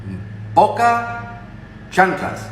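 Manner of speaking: "poca" stretched very slow and high; stress on poca. Shown as a demonstration. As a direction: draw out "poca", then drop on "chanclas". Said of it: "en Huelva dicen".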